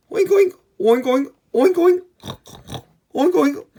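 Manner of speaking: very high, loud oinking; pig oink. Pretend. Showing kids what pigs say, loud squeaky oinking. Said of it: "The pigs say".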